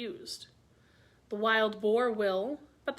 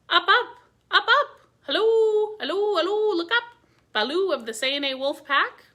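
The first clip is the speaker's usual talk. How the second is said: much higher, much louder call from the sky; calling from far above; 9 semitones higher, 10 dB louder than usual. Acted. Chil the Kite calling down to Baloo from the sky. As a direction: shout down from high overhead like a kite. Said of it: "looked up to see where the voice came from".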